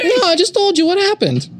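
put-on high, tough-guy Boston accent; Boston Joe Pesci. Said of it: "I'm gonna be a Boston Joe Pesci".

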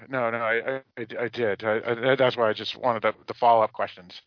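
normal talking voice

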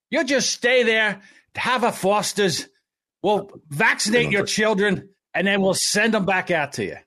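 put-on a light Australian accent with a bossy official tone; an Australian official lightly mocked in an Australian accent; Australian accent, lightly done. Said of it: "I'm gonna do it as a Taliban".

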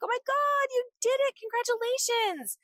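very high-pitched, excited squeal of congratulations; celebrating friends. Acted. Friends calling to congratulate you, high excited cheering. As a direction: gush like a thrilled friend, voice way up high. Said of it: "friends and family are just encouraging us and celebrating us".

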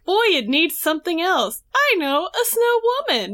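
high, perky mock musing; Betty's chipper act. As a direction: rise brightly into a pleased discovery. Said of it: "whole thing where she's just chipper".

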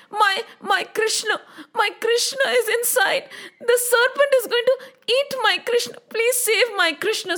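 high, halting, tearful plea; desperate pleading. Acted. Yashoda begs them to save her Krishna, desperate. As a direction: beg as a mother in panic. Mother pleads before fainting.

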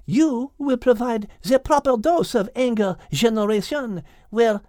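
put-on lightly French-accented lady's voice; a hint of French accent. Voices French characters Liz, Henriette.

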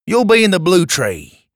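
put-on a light Australian accent; cheery Aussie instruction. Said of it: "in an Australian accent".

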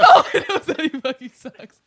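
high loud laughing through the words; real laugh while talking. Real, not acted.

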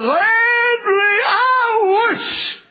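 loud, high, slow cry. Retold or imitated. Preacher crying out for Landry the third time. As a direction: wail the name then drag out the wish. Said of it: "The third time he said".

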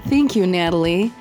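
usual speaking voice

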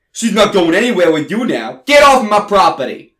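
put-on loud Italian-accented father. Father ordering songwriter off his property, Italian accent. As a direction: throw him out in an Italian accent. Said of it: "speaks with an Italian accent".

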